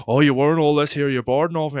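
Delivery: scornful retort. Acted. Retold bouncer dismissing the teller.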